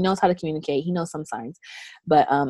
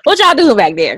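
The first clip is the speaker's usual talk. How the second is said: louder, higher call; 7 semitones higher, 7 dB louder than usual. Retold or imitated. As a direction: call the kids from afar. Calling out to kids.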